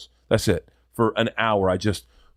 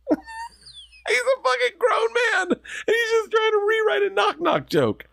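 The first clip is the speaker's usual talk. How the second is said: high, cracking laugh through the words. Real, not acted. Comic laughing at a grown man's knock-knock joke. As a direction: squeal it out while laughing.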